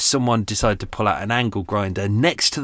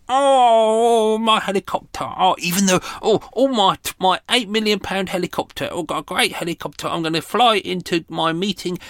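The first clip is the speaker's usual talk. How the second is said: high, louder sneering impression of a rich pilot; mocking posh helicopter owner; 9 semitones higher, 4 dB louder than usual. Vlogger mocking helicopter pilots flying past, high sneering voice. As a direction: lampoon a smug rich man bragging. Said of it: "wankers in the helicopters ... going".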